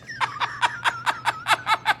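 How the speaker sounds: very high, rapid staccato giggle; high cackle. Pretend. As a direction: giggle like a machine gun up high. Cackles after starting show.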